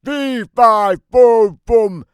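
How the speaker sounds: loud, high booming chant; giant's bellow. Acted. Giant calling out for the hidden boy, booming. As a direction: bellow it through the house. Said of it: "I said in giant".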